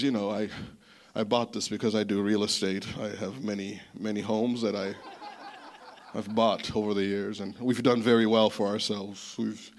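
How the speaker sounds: a hint of a self-satisfied rich man; proud rich man. He didn't say it like that.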